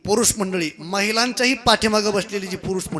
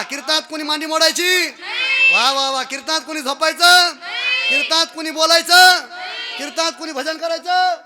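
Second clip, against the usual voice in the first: high, raised prompts; loud chant-like quizzing; rousing call-and-response; 8 semitones higher, 6 dB louder than usual. Real, not acted. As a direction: fire questions at the crowd for a shouted answer. Drilling crowd in loud responses.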